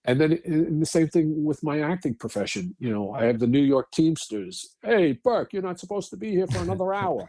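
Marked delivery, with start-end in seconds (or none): 4.8-7.3 raised, higher voice calling out; Teamster heckle. Retold or imitated. New York Teamsters ribbing the actor for early arrival, imitated call. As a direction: call it over to a coworker, high and punchy.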